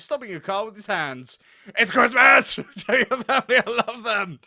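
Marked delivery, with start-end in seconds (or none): 1.8-4.4 loud, manic shout at a car. A narrator's reading. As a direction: bellow it with a laugh breaking in. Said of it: "Carl shouted".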